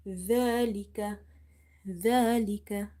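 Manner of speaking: slow ذلك with tongue tip on the teeth; tongue-tip zal. Shown as a demonstration. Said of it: "Zal from the tip of the tongue".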